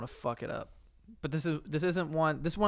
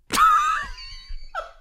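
high shrieking laugh; loud burst of laughter; 8 dB louder than usual. Real, not acted. Hearing the made-up disease name, shrieking laugh. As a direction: cackle out loud at the silly word. Big laugh at dream word.

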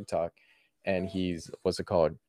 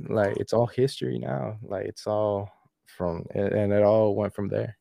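usual speaking voice

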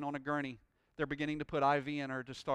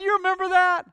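loud, fervent pulpit preaching; full-force preaching; 10 semitones higher, 18 dB louder than usual. Acted. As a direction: preach it out with rising joy.

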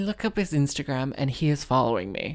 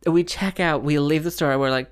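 normal talking voice

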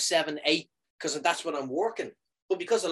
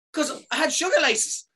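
much higher, pushing the word "sugar"; exasperated insisting; 12 semitones higher, 3 dB louder than usual. Retold or imitated. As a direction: stress "sugar" high and fed-up. Insisting to nurses.

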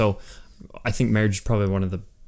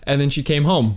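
usual speaking voice

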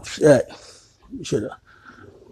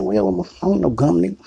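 mock indignant refusal; 7 semitones higher, 5 dB louder than usual. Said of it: "they get mad like".

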